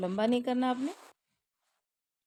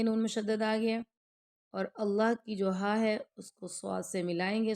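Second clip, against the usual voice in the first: ordinary talk, nothing marked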